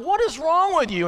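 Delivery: stuck again behind the truck, high exasperated outburst; frustrated driver. Retold or imitated. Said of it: "and he slows down".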